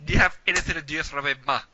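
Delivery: rushed, unclear thug mutter; Mickey gabbling a question his listener can't understand; fast mumble. Acted. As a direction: rattle it off too quickly to follow. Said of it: "I can't understand you".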